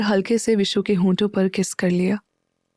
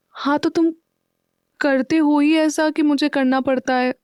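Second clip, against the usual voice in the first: put-on higher, cutting-in girl's voice; girl interrupting; 6 semitones higher than usual. A narrator's reading. Girl cuts off her boyfriend, higher and quick. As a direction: jump in before he finishes. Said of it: "Vishu बीच में ही बोल पड़ी".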